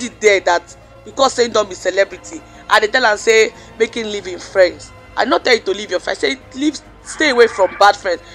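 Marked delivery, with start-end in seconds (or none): none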